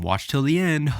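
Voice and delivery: mock caption voice. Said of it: "They'll put on the caption".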